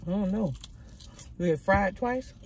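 talking between bites; eating while speaking; mouth full, chewing. Real, not acted. Seen 1: Bites a small fried piece at 0.8s, then chews while talking.

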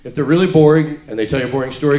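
ordinary talk, nothing marked

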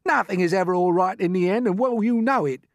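loud, high, gloomy protest; scornful earthworm cry. A narrator's reading. Earthworm contradicts the hopeful grasshopper, crying out. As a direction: insist on doom, loudly. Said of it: "cried the earthworm".

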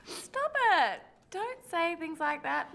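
high, touched stop it; high, falling protest; flustered protest. Acted. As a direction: bat the compliment away, high-pitched. Flustered by compliments, near tears.

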